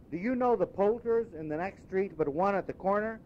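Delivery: high, eager, then slowing warmly; an old man suddenly beaming at a stranger; delighted Scrooge. Acted. Scrooge asking the boy the day, delighted.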